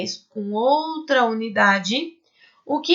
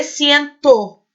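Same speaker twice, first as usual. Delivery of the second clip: "ciento" slowly, with the added "to" brought out loudly; stress on TO; 4 semitones higher, 5 dB louder than usual. Shown as a demonstration. Teacher shows the ending added after cem, "to" stressed in "ciento". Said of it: "Eu acrescento o to aqui no finalzinho".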